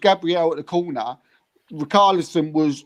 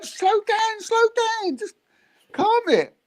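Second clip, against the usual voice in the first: retold shouting at the keeper. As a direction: replay yelling from the stands, pitched way up. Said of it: "And I'm going".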